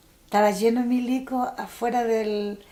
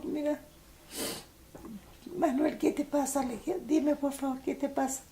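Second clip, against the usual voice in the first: higher, softer anxious plea; pleading; 5 semitones higher, 8 dB quieter than usual. Retold or imitated. Sister begging her dying brother on the phone, higher and softer. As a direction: beg him to answer, voice raised and hushed.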